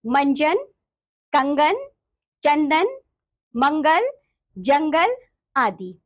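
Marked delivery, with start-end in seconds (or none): none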